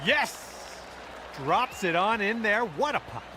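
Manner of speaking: high, excited cheering at a putt; root for the ball, then celebrate. Real, not acted. Cheering a long putt dropping.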